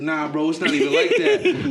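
mock dismissive denial, laughing; laughing while mimicking his brush-off; imitating the guy. Friend quoting a seller's excuse, laughing mimicry. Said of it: "He was like".

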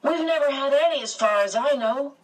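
high, lively exclamation; exclaiming. A narrator's reading. Calvin telling the beast Earth has no visitors, exclaiming. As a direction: exclaim it, pitch jumping up. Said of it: "Calvin exclaimed".

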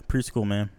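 ordinary talk, nothing marked

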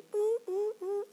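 high-pitched hooting "uh" noises; imitated animal calls. Mountain hiker imitating eerie animal sounds, high hoots. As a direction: mimic night animal cries, pitched high. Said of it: "banyak itu yang bunyi-bunyi".

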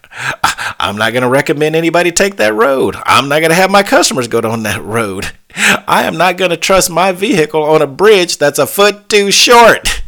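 "deliver" voice rising to a loud, high shout by "too short"; building mock shout. Supervisor scolding the engineer over the short bridge, building to a shout.

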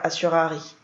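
ordinary delivery, nothing marked

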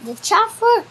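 usual speaking voice

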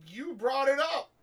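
high, slow, forceful retort; loud accusation. Real, not acted. Answering a denial, loudly accusing. Accusing someone who denies it.

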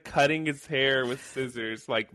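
ordinary delivery

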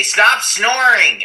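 high, loud, slow mock scold; annoyed shout. Moviegoers telling him to stop snoring, a mock angry shout. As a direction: bark it like an irritated moviegoer.

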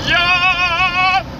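very high, long held yell; silly scream. Shown as a demonstration. Demonstrating noises made alone in the car, shrieked. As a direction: let out a daft car shriek. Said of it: "screaming in my car and just making funny noises".

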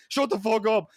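high, loud mock snap; angry retort. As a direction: shut down the laughers in a re-enacted outburst. Acted angry retort to laughing girls.

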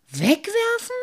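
put-on a boy outraged at the idea; flaring up. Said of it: "brauste der kleine Wassermann da aber auf".

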